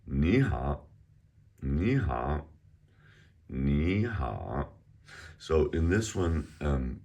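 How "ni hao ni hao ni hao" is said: "Ni hao", Ni rising, hao dipping; tone demonstration. Shown as a demonstration. Teacher reading the dialogue, "Ni hao" with rising then dipping tone. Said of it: "So it's a rising tone and then a third tone".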